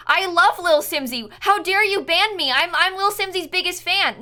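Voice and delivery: high, loud mock-pleading banned viewer; whiny fake fan. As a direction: whine like a banned troll begging. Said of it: "come back and be like".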